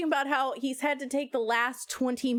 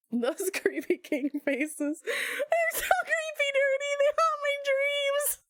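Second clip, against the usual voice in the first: high, slow, half-laughing whine of disgust; laughing mock horror; slower than usual. Real, not acted. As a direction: squeal it out, laughing and creeped out. Mock horror at creepy king.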